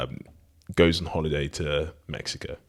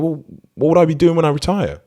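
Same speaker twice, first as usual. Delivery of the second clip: high, quick, louder acted question; questioning fisherman; 11 semitones higher, 5 dB louder, faster than usual. Fisherman asking the businessman about retirement, questioning. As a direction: raise the pitch and hurry the query.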